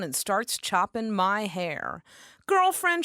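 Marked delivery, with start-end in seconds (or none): 2.5-3.0 put-on high, loud sassy Nuyorican girl; lightly voiced Puerto Rican stylist. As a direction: belt it out like a brassy salon girl. Susie voiced by narrator.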